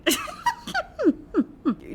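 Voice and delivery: high-pitched burst of giggles; laughing. Real, not acted. Laughs at the passage.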